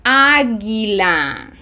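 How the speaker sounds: "Águila" slow and loud, stressed on the first A; drawn-out model of "Águila"; silent u. Shown as a demonstration. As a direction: punch Á in "Águila", gee with no w. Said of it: "The U is silent".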